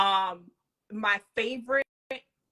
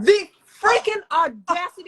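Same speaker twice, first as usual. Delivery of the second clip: loud, higher exclamation of outrage; outraged; 6 semitones higher, 9 dB louder than usual. Real, not acted. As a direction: blurt out indignation. Outraged reaction to episode.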